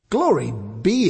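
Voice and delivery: a touch of amazed delight; astonished exclaim. Acted. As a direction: gasp it out, lightly amazed. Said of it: "exclaimed the farmer".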